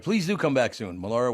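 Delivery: ordinary talk, nothing marked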